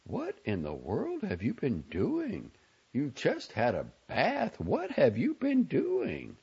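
slow, high mother's interrogation; scolding mother, rising in pitch; wonder and censure. A narrator's reading. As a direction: draw it out high, amazed and reproachful. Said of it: "Her tone couldn't have held more wonder and censure".

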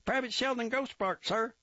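high, eager recruit reporting; Grossbart voice. A narrator's reading. Recruit reporting to the captain, high eager voice. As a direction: snap it out high and keen.

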